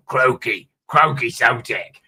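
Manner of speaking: mock impression in a weird, drawn-out accent; weird Sotek accent. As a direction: put on an odd accent for a croaky character. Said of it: "he goes weird and that sort of accent".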